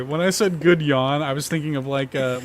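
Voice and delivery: ordinary delivery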